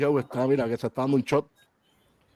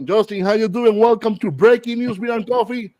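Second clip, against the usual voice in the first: lucha libre announcer; 8 semitones higher, 5 dB louder than usual. Acted. Host welcomes guest, show intro.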